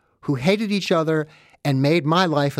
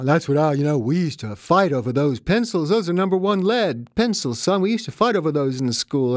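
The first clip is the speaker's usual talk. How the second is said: hint of an old-timer reminiscing fondly; nostalgic father; 3 semitones higher than usual. Father telling his son about Depression-era gifts, lightly voiced.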